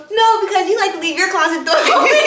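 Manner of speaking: laughing. Retold or imitated. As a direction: quote it fast through laughter. Retelling teasing while laughing.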